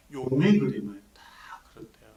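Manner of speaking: hushed whisper of 용의 그림을; storyteller's whisper. Real, not acted. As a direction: lean in and whisper as if telling a secret.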